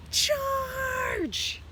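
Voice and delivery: high, drawn-out charging cry; battle yell. A narrator's reading. Hamster running at the food bag, yelling.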